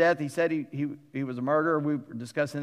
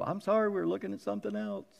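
eyes apologizing; 5 semitones higher, 4 dB quieter, faster than usual. Pretend. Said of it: "how my body talks to itself".

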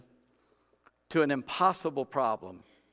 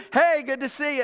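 high, louder glad-hander hello; eager greeter working the crowd; cheery greeting; 9 semitones higher, 5 dB louder than usual. Retold or imitated. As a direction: greet them like an old friend. Andrew greeting the crowd again.

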